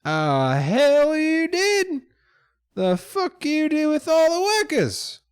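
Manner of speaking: loud, high indignant yell; outraged high-pitched shouting; Don John. Acted. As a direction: holler an accusation at the intruders. Mine boss quarrels with rats.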